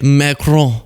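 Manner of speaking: "Macron" as mah-kroh, final n unsounded; French-style ending on "Macron"; French pronunciation. Shown as a demonstration. Commentator showing how to say "Macron", French pronunciation. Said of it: "That's how you say it".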